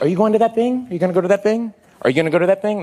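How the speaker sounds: high-pitched imitation of a woman; Kristen Wiig. Co-star always asking about that thing, high imitation. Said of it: "That's what she always says".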